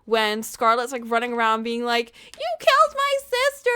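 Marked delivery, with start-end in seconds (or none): 2.3-3.7 high, loud mock-hysterical cry; imitating a hysterical outburst; freaking out. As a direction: act out a frantic accusation, pitched way up. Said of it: "she's, like, freaking the fuck out".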